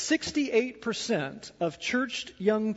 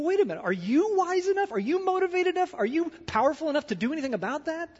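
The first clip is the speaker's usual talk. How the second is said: much higher, fast, mocking taunt; sneering monster; 9 semitones higher, faster than usual. Preacher voicing the inner monster taunting the listener: high fast sneer. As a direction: sneer the questions like a jeering bully. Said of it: "the monster sneers at you".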